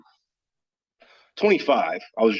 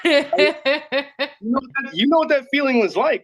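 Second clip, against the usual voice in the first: laughing; 12 semitones higher, 3 dB louder than usual. Real, not acted. Laughing with host.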